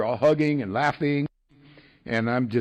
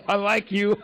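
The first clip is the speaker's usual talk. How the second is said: higher, through a big grin; grinning old-timer; 7 semitones higher than usual. Retold or imitated. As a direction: beam at the newcomer as you say it. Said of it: "this guy's got a big grin".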